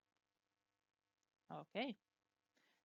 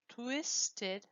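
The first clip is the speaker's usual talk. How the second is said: ordinary delivery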